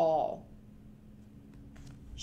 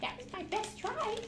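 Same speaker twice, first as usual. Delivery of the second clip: high, fast, playful goat puppet voice; 11 semitones higher, 7 dB quieter than usual. Acted. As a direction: chatter like a greedy little goat.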